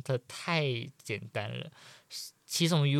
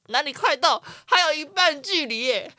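higher and louder, exasperated; indignant protest; 13 semitones higher, 6 dB louder than usual. Retold or imitated. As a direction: shout back in disbelief. Arguing with friend on long walk. (不可置信地高声抗议)

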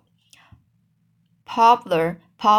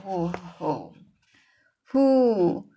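hooting echo call; slower than usual. Acted. A boy hooting across the river to make echoes, playful owl calls.

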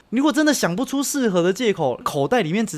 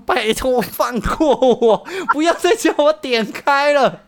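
high, slow mock begging; pleading coworker; 8 semitones higher, 4 dB louder, slower than usual. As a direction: plead to be left alone, hammed up. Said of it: "他说".

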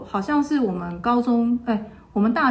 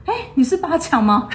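imitating a senior's high surprised call, a touch louder; mock double take at a friend; surprised recognition; 7 semitones higher, 5 dB louder than usual.

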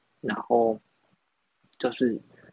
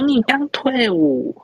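mock surprised question; 11 semitones higher, 5 dB louder, much slower than usual. Salesman surprised he just left army.

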